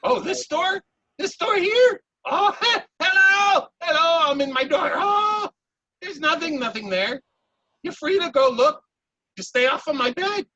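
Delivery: high, loud teasing otter voice calling hello; high sing-song otter playing peekaboo; playful otter. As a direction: tease them from behind the door.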